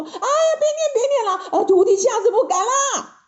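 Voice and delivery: high, acted yelps of agony; hurting Monkey King pleading; crying out in pain. A narrator's reading. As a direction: beg for mercy in a high pained cry. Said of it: "疼的大叫说".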